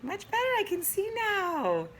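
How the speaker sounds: high, bright relief; relieved squeal. Real, not acted. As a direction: chirp it out relieved. After adjusting her view.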